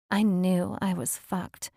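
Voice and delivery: ordinary delivery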